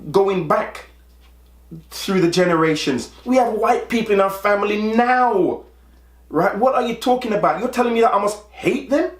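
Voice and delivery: high, fast, incredulous outburst; exasperated. Retold or imitated. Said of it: "I'm like".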